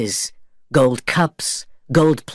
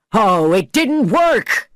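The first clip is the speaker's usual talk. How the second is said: higher, slow pirate dismay shout; 9 semitones higher, slower than usual. A narrator's reading. Pirates shouting at the failed ritual, high slow outcry. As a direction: wail it out like betrayed pirates. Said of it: "they shouted".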